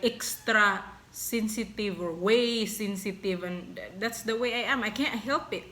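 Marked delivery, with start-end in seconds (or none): none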